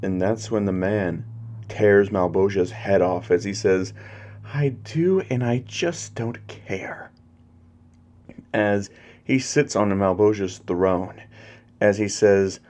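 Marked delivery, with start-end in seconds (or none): 4.4-7.1 high, slow villain voice, softening; villainous indifference. Acted. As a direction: start high, then drop to a slow, soft taunt. Usurper sneers while killing his master.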